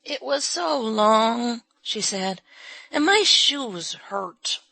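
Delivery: put-on very slow, high tired child's voice; weary child. A narrator's reading. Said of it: "weary little sigh".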